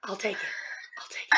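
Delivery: soft whisper, amused; whispered acceptance. Acted. Player answers Jacob's joking threat in a whisper. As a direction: breathe it out, taking the joke. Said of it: "player whispers acceptance".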